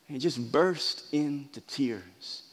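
hushed, slow. Real, not acted. Describing Jesus weeping.

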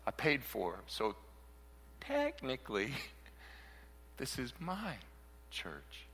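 soft, understated calm reply; Jesus correcting gently. Retold or imitated. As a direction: gently set the record straight, a touch of humor. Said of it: "Jesus going".

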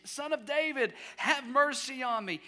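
high-pitched pleading call; mock scream. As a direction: keep crying out, held short of screaming. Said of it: "He continued to scream".

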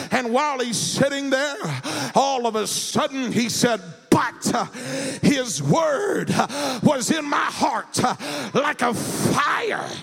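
rising sung preacher whoop, slow and high; preaching at full force. Acted. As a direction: chant the sermon with an uh after each phrase. Whooping preacher tells Jeremiah story.

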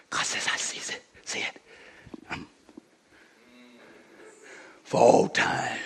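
whispered, intimate aside; breathy hush after the shouting. Acted. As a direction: drop to a whisper so they lean in. Hushed aside after loud preaching.

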